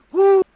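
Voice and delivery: sudden high, loud whoop; whooped "woo". Acted. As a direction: let out a big high "woo". Character clearing the room.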